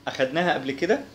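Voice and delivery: normal talking voice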